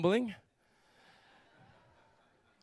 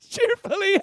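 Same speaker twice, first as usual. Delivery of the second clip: exaggerated cheerfulness; 12 semitones higher, 5 dB louder than usual. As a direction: sing out the word in a silly high voice. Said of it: "Saul greeted him cheerfully".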